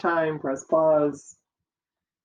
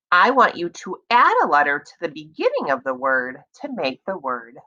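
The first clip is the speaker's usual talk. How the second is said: ordinary delivery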